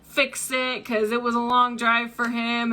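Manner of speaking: ordinary talk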